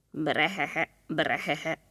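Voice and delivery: croaky "brehehe" frog laugh; frog chuckle. Acted. Frog laughing at the others from the mushroom, croaky chuckle. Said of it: "heherészett valaki".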